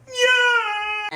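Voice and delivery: high, loud scream; goofy imitation scream; Mike Wazowski scream. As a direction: mimic his scream. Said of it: "I would try to mimic".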